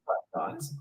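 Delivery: breathy whisper of the greeting; whispered imitation. Streamer whispers the YouTuber's greeting back. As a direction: whisper "Hi, guys" to yourself. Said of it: "I love how he says it".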